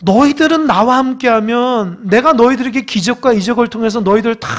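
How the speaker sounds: ordinary talk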